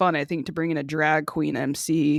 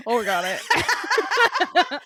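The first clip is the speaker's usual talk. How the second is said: witch laugh; 10 semitones higher, much slower than usual. Pretend. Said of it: "Were you trying to do a witch laugh?".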